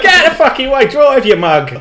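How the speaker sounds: high, loud imitation with laughter in it; hammed-up impatient pedestrian; mock angry yell. Old woman yelling at the driver to move, mock angry shout.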